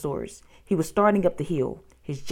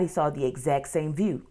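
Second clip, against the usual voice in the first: usual speaking voice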